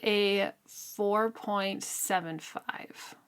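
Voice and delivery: slow breathy whisper of the score; hushed, teasing score reveal; playful whisper. Real, not acted. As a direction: whisper the rating like a secret, slowly. Playful whispered book rating.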